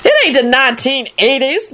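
high-pitched scornful retort; disdainful. A narrator's reading. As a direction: dismiss it with lofty disdain. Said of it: "Lucretia replied disdainfully".

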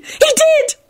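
high gleeful squeal. Acted. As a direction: gloat it with glee. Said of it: "Elizabeth hissed gleefully".

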